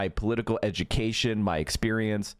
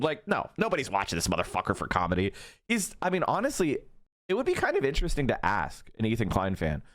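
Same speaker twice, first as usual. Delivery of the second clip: ordinary delivery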